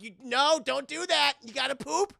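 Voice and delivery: loud, high, urgent telling-off; parental scolding. Acted. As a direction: stop a toddler in alarm. Parent scolding a baby, acted.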